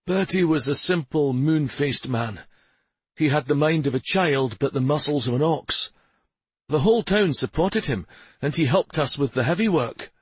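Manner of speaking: a hint of a mayor recalling a townsman; mayor's voice. A narrator's reading.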